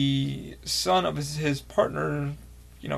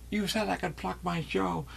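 slow, soft, lightly voiced character line; a light impression of the villain, drawn out; imitating the actor's voice; 4 semitones higher, 5 dB quieter, slower than usual. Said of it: "Hearing that voice as the fucking board show".

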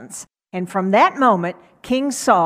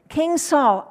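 calling out; 7 semitones higher than usual. Acted. David calls out to the king.

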